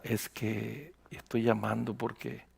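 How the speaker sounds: barely above a murmur, embarrassed; very quiet voice. Retold or imitated. Pastor imitating a timid phone caller, very quiet. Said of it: "y una voz muy bajita".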